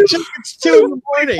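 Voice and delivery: high, loud, laughing disbelief; host at two a.m. refusing Chuck, high and laughing; laughing protest. Retold or imitated. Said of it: "I'm like".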